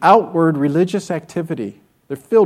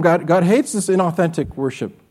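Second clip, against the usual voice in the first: ordinary delivery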